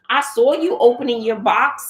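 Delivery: ordinary talk, nothing marked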